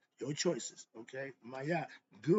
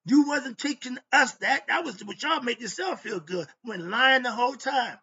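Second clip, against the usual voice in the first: pissed-off rant; 4 semitones higher, 9 dB louder than usual. Real, not acted. Reading the Declaration aloud and angrily tearing into it, a loud rant. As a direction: tear into every line you read, furious. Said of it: "I'm pissed off right now".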